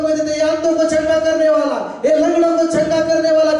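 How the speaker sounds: high shouted proclamation; impassioned sermon cry on a sustained high pitch; full-force preaching. Real, not acted. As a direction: declare it to the whole congregation.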